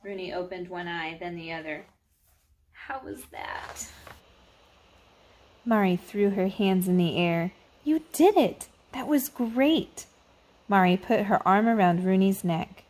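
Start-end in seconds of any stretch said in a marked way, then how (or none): 2.7-3.9 very soft, slow, breathed question; tentative hushed question. A narrator's reading. Girl checking after her first prayer, hushed and tentative. Prayer ends, asks shyly. 7.8-10.1 high, loud congratulation; excited cheering. A narrator's reading. Mari praises Rooney after her first prayer, excited cheering.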